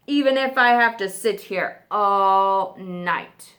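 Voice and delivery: loud roar then threatening growl; hungry lion waiting for dinner; menacing lion. Acted.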